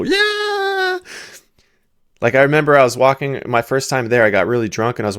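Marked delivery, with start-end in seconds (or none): 0.0-1.0 very high, drawn-out mock cheer; cheering street kids. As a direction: imitate kids thrilled with a dollar. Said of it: "they give 'em a dollar and they go".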